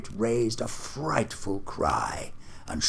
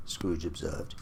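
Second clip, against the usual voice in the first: usual speaking voice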